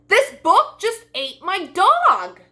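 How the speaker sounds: high, slow, loud dismayed voice; Bella's alarm. Acted. Bella tells Ben her dog vanished, high and alarmed. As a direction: Bella wails at the missing dog.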